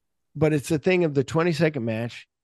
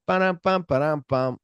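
sung drum rimshot, higher; mock rimshot; 3 semitones higher than usual. Mocking the movie's corny punchline with a sung rimshot. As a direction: hum a joke drum hit to mock the line.

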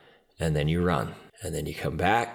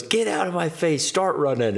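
high-pitched mock command; angry coach; 10 semitones higher than usual. Coach ordering trainees to run, retold anger. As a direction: imitate a coach sending them off in disgust.

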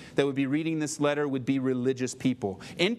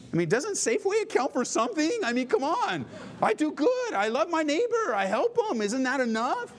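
much higher, pleading indignation; indignant whine; 10 semitones higher than usual. Retold or imitated. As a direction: demand credit for your good deeds. Indignant self-defence continues.

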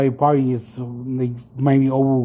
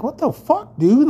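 very high, incredulous cry; real exasperation; 13 semitones higher, 3 dB louder than usual. Real, not acted. Still fuming about the stranger, high incredulous outburst. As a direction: throw up your hands in disbelief, up high.

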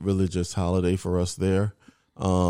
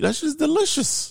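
raving about food. Real, not acted. Raving about Easter bun.

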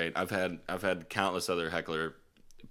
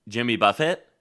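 put-on curt drunk request; 4 semitones higher, 8 dB louder than usual. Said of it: "Just [name] with a question mark".

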